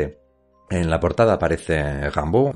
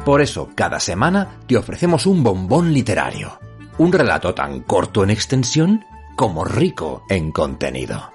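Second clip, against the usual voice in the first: locutor de radio, enfático y cercano; right in your ear; 3 semitones higher than usual. Acted. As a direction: presenta el programa como un locutor. Intro de podcast con música. (voz de locutor pegada al oído)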